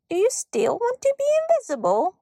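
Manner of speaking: high, squeaky-pitched question; eager little elf. A narrator's reading. Elf waking girl at night, eager little voice. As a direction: lift it high like a teasing sprite.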